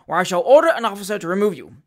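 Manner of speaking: fast, loud official threat; peremptory threat. A narrator's reading. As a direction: threaten him with your authority. Magistrate threatens to remove defendant.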